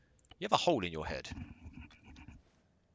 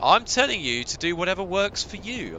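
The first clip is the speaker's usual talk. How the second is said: exasperated; 10 semitones higher, 11 dB louder than usual. Real, not acted. Heated back-and-forth with gunner.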